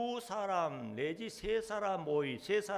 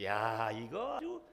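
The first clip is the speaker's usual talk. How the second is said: usual speaking voice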